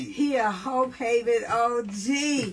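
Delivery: slow, loud hype echo; excited hype. Real, not acted. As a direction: hype it up for the crowd. Host echoes guest, hyping loudly.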